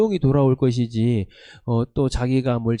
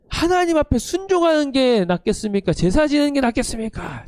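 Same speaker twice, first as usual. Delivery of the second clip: high, indignant rhetorical demand; mock angry shout; 9 semitones higher than usual. Prophet Samuel shouting at disobedient Saul, pitched high. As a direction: rebuke him in a raised, high voice. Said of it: "지금 이렇게 막 소리 지르는 겁니다".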